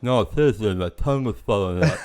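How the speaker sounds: pushing the joke, half laughing; insistent with a laugh. Retold or imitated. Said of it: "and I'm like".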